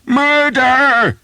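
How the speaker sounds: very high, loud desperate scream; shrieking murder. A narrator's reading. Pinned archer shrieking for help, desperate scream. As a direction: scream for your life. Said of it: "he screamed".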